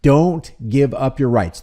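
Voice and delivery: ordinary talk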